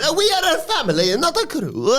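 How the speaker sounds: high, laughing mock Italian-American voice; laughing Italian-American accent; Italian mobster impression. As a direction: lay on the wiseguy accent. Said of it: "Lucas says".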